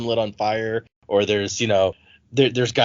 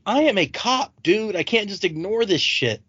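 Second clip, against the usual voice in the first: higher, pushy mock cop insistence; exasperated cop; 6 semitones higher than usual. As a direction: play the fed-up cop, a pitch higher. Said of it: "And she's like".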